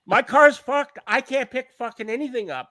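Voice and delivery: retold outburst, pitched well up; exasperated protest. Explaining to the flatmate why no lift, exasperated protest.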